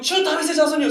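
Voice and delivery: high-pitched yell at someone's back; imitated sudden shout. Woman yelling at the back of Jesus's head, sudden high shout. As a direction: burst out calling after him. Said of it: "소리치는 거예요".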